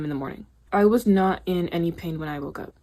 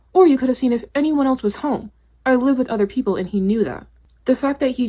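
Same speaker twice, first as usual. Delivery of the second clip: angry; 6 semitones higher, 5 dB louder than usual. Real, not acted. Raging at coworker who left her.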